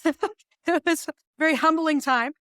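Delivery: slow, laughing confession. Real, not acted. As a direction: laugh through an embarrassed memory. Laughs about spandex on TV.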